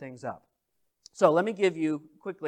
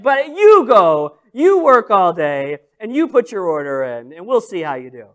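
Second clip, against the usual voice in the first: mock onlookers; 13 dB louder than usual. Voicing judgmental onlookers.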